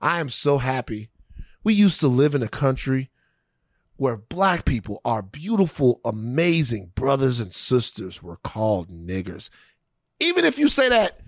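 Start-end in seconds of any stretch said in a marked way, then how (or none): 0.0-9.5 mock formal speech. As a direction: parody a pompous luncheon speaker, lower. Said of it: "giving a speech to the fucking Rotary Club".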